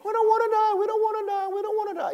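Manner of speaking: high, whiny repeated plea; high comic whine, fading; mock whimper. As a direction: ham up scared people hiding. Preacher mimics scared churchgoers.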